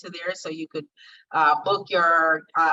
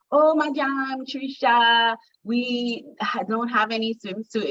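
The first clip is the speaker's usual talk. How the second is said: put-on higher-pitched French-accented stylist, opening on a drawn-out cry; French English accent; 5 semitones higher, slower than usual. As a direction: imitate a dismayed French stylist speaking English. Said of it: "in her little French English accent".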